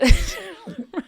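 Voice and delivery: loud, high burst of laughter; real helpless laugh. Real, not acted. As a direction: crack up at your own bit. Cracks up at own French rap.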